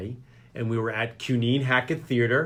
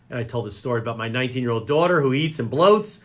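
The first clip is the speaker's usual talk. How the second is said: ordinary delivery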